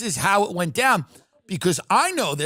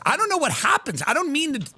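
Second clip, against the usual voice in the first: ordinary delivery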